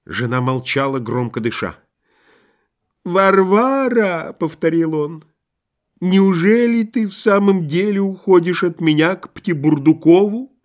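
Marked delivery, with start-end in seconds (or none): 3.0-5.2 whining husband's call; nasal repeated plea. A narrator's reading. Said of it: "сказал Лоханкин в нос". 6.0-10.5 high, wounded husband's question; hammed-up pleading; plaintive disbelief. A narrator's reading. Abandoned husband pleads with wife.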